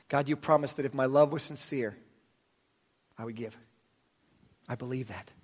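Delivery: soft, hushed prayer voice; quiet prayer. Acted. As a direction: pray it softly and sincerely. Leads a prayer.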